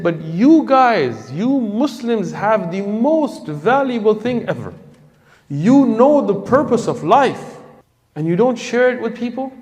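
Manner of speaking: slow, high-pitched, emphatic accusation; impassioned reproach. Retold or imitated. New convert to the Muslims who never shared, impassioned reproach.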